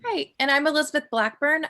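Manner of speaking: ordinary delivery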